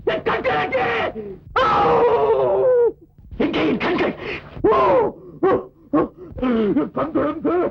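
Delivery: suddenly blinded villain's panic; panicked blindness. Acted. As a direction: shout for your lost eyes in terror.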